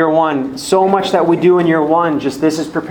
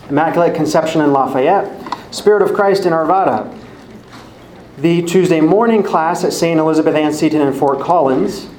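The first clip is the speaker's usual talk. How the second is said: ordinary delivery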